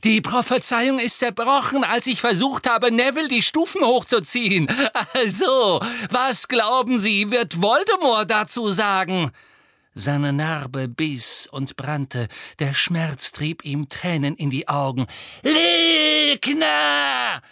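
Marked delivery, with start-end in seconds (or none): 0.0-9.3 high, loud taunt; defiant shouting. A narrator's reading. Boy taunts the witch about Voldemort, defiant shout. 15.4-17.4 very high, loud shriek of accusation; shrill screech. A narrator's reading. Witch accuses Harry of lying, screeching. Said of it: "kreischte sie".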